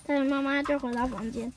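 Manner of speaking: ordinary delivery, nothing marked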